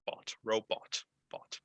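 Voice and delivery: whispered demo. Shown as a demonstration. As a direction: whisper bought like robot. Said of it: "como un robot".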